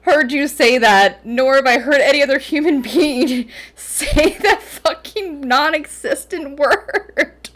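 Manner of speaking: loud and high, laughing through tears; crying with laughter. Real, not acted. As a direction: push the protest out between fits of laughter. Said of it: "She's crying, folks".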